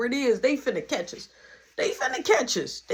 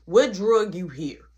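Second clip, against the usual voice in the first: ordinary talk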